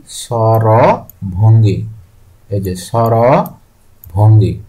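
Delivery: very slow, syllables stretched out; drawn-out, deliberate teaching pace; slow dictation. Real, not acted. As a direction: say it slowly so learners can write it. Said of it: "আমি খুব slowly আজকে ক্লাসটি করব".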